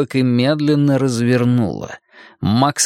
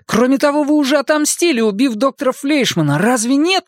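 put-on frightened boy; 13 semitones higher than usual. Max presses the villain.

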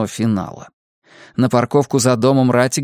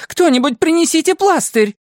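high-pitched agitated command; woman's high, frantic order; barked order; 3 dB louder than usual. A narrator's reading. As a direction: call out urgently and crossly. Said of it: "рявкнула Мэгги".

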